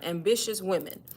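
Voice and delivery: ordinary delivery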